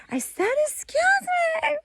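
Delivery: put-on very high, indignant kid voice; imitating upset child. Son repeating himself to Grandpa, upset high child voice. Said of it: "like, upset".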